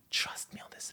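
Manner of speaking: breathy whispered plea; hushed trust me. Real, not acted. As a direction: lean in and whisper it. Quiet urging aside.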